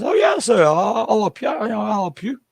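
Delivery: put-on lightly accented shopkeeper offering help; mock shopkeeper. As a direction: imitate the Thai shopkeeper. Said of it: "And he says".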